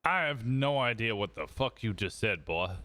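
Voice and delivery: ordinary delivery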